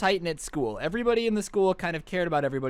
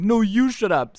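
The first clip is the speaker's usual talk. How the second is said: angry retort; 3 semitones higher, 5 dB louder, slower than usual. Retold or imitated. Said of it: "angry and be like".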